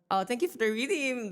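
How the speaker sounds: normal talking voice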